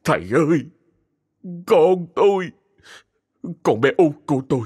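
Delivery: a desperate father begging the master; choked up. Acted. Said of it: "giọng nghẹn ngào". (giọng nghẹn ngào van xin, cao hơn)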